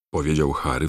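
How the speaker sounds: plain reading voice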